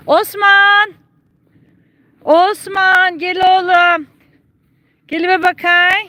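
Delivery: high, loud drawn-out animal call. Real, not acted. Owner summoning her donkey Osman, calling out high and loud.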